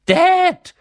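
put-on high, loud, abrupt boyish call; sudden call. A narrator's reading. Dudley suddenly alerts his father, calling out high and loud. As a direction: burst out, pitched high, to get attention. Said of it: "sagte Dudley plötzlich".

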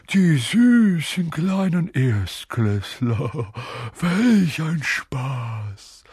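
slow, high poltergeist sing-song; mischievous, drawn-out taunt; spiteful gloating. A narrator's reading. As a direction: drag it out with malicious glee. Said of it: "sagte er mit einem gehässigen Kichern".

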